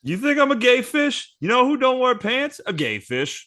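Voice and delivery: high, mocking [name] rant. [name] answering the fish-stick question, high and mocking. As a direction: pitch it up and sneer it out.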